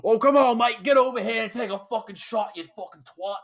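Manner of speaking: high, loud British lad egging a mate on; boisterous mock British cursing; British lad accent. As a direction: imitate a rowdy British bloke. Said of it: "type of guys who are like".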